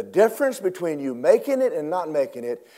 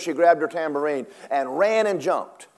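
ordinary talk

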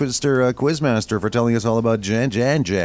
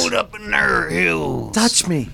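put-on high, slow old gold miner voice; grizzled prospector; 7 semitones higher, much slower than usual. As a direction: stretch it out like a hammy old prospector. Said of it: "Talk Like a Grizzled Prospector Day".

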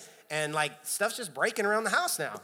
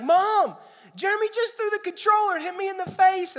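put-on much higher, loud wailing child tattling to mom; screaming crying kid; 14 semitones higher, 7 dB louder than usual. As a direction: wail it to mom like a hurt four-year-old. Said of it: "I'm screaming and I'm crying".

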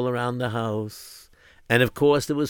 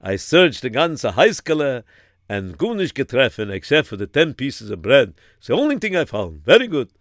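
put-on old Rav with a Yiddish accent; elderly rabbi in a Yiddish accent; 4 dB louder than usual. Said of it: "And then the Rav said".

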